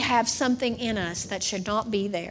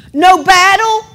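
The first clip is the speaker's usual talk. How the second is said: loud preaching cry; 6 semitones higher, 11 dB louder than usual. Acted. Preaching contrasts.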